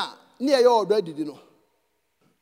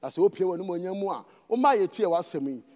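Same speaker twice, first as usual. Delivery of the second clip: softer, lower prayer voice fading to a hush at amen; closing prayer; 5 semitones lower, 3 dB quieter, faster than usual. Acted. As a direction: pray humbly, drifting down and quieter. Leads closing prayer in Twi.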